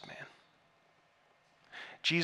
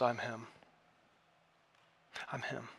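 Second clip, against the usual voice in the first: much softer, fading almost to nothing; quiet claim; 4 semitones lower, 11 dB quieter than usual. Acted. Jesus telling the crowd he is the Messiah, very softly. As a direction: let it drop to a near hush, a quiet revelation.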